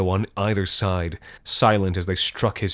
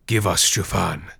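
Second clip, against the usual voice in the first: echoing ethereal energy being. A narrator's reading. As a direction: chant it like spirits. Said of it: "they murmured".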